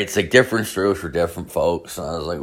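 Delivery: ordinary talk, nothing marked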